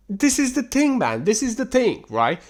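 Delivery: high-pitched insistent complaint; exasperated. Real, not acted. Exasperated rant about such guys.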